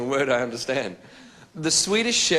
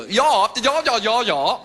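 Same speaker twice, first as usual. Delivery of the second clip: high, louder chirpy ya ya; mock posh ya ya; 9 semitones higher, 5 dB louder, much slower than usual. Frenchman mimicking the English woman on the phone, high mock posh. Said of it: "this English woman saying".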